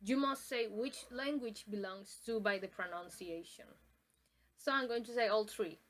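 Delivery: ordinary talk, nothing marked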